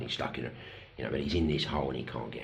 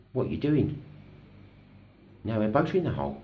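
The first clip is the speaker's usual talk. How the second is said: high, loud, acted disbelief; incredulous dismay; 8 semitones higher, 5 dB louder than usual. Addict to the man who jumped into the hole, incredulous. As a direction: protest in alarm, pitch jumping right up. Addict startled as rescuer jumps in.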